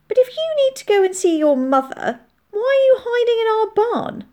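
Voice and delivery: put-on high, loud child's question; puzzled child, challenging. A narrator's reading. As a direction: press the soldier for an explanation as a child.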